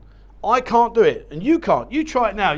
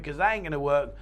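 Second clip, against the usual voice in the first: ordinary talk, nothing marked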